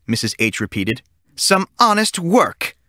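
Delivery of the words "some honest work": slow, higher, deliberate teacher voice; teacher handing out a punishment slowly; slow stern teacher. A narrator's reading. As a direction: lay down each word like a witchy teacher.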